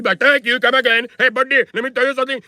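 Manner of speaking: high, lightly mocking Indian accent; a hint of caricatured Indian accent. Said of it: "Where the fuck did I get this accent from?".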